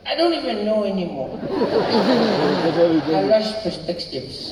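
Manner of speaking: Indian schoolboy friend. Retold or imitated. Schoolboy venting to his friend about leftovers, exasperated and building. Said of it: "He said".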